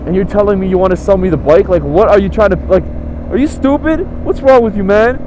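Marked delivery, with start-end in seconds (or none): 1.8-5.2 higher, exasperated scolding; fed-up, incredulous questions; pissed off. Retold or imitated. Annoyed buyer telling off the seller, angry. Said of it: "I was so pissed and just angry".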